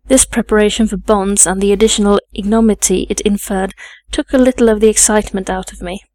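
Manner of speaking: ordinary delivery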